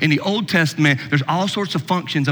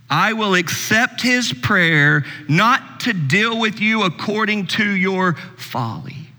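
slow, higher, measured Bible reading; scripture reading; 5 semitones higher, slower than usual. Acted. Preacher rereads verse eight to explain why, slow scripture reading. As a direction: read the verse deliberately, phrase by phrase.

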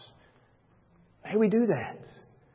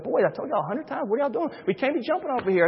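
put-on pitched-up scolding of the kids; 7 semitones higher, 4 dB quieter, faster than usual. Father scolding his sons for jumping off furniture, exasperated. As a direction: tell the boys off, exasperated. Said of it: "I said".

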